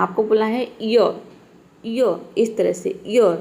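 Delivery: य said with the r almost dropped; य drawn out, r barely heard; pronunciation demo. Shown as a demonstration. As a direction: model य slowly for learners. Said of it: "न के बराबर आर को pronounce करना है".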